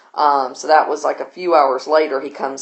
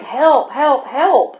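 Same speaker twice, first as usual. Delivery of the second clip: high-pitched mock cry for help; retold shouting; 8 semitones higher, much slower than usual. Voice upstairs in a struggle, shouting. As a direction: imitate distant shouts, pitch up but volume held back. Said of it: "and then a voice shouting".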